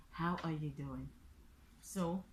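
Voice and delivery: normal talking voice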